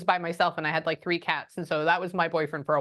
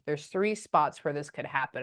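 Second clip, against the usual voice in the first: ordinary talk, nothing marked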